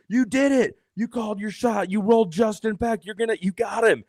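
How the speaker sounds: very high, shouting on-air cheer; hyped celebration. Retold or imitated. Announcer celebrating the fan's roll on air, high excited shout. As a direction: cheer like he just hit the jackpot.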